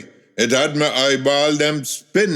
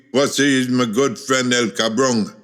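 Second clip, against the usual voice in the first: ordinary delivery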